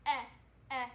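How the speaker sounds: "eh" said as a short, clipped e vowel; short e sound. Shown as a demonstration. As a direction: say "eh" like the e in elephant. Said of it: "it makes the".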